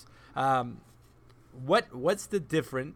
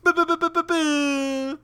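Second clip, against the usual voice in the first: high blaring boo boo horn; air horn imitation; 13 semitones higher, 5 dB louder than usual. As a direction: blast it like a rap air horn. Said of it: "rap air horn".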